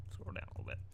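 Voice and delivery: whispered, low and soft aside; breathy aside to the audience. Real, not acted. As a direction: drop to a whisper for the viewer. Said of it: "aside to viewer".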